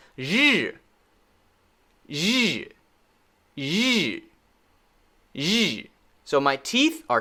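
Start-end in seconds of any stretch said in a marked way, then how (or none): 0.1-5.9 sustained voiced "zh" buzz; voiced sh, as in vision, drawn out; American ZH sound. Shown as a demonstration. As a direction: hold a higher-pitched "zhhh". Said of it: "in American English, we say this one as".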